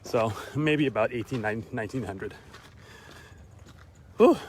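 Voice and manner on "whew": winded whew. Real, not acted. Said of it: "I started off a little bit too fast".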